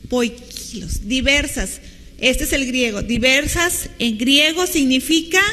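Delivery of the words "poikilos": soft, slow "Poikilos", drawn out on KI; stress on the I. Shown as a demonstration. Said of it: "lleva acento en la I". ("Poikilos" suave y lento, con la i acentuada y larga)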